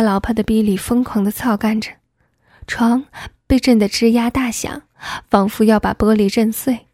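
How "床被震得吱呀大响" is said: usual speaking voice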